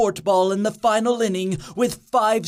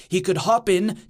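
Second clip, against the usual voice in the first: usual speaking voice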